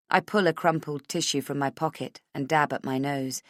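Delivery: usual speaking voice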